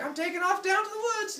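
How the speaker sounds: put-on very high little-kid voice; high-pitched baby adventurer. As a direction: announce it like an eager toddler. Baby Hermes sets off alone.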